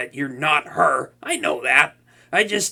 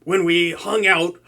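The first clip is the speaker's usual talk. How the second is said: normal talking voice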